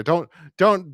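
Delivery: ordinary delivery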